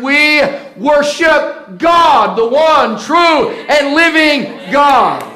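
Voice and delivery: loud, slow shout of praise; shouting worship. Acted. Pastor shouting God's name to the church, loud. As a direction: lift it up with a shout. Climax of preaching.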